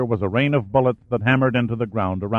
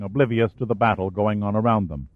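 ordinary delivery, nothing marked

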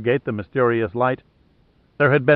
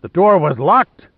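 loud, slow, higher bellow; 6 semitones higher, 6 dB louder than usual. Acted. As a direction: bellow it in disbelief. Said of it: "Lohan bellowed".

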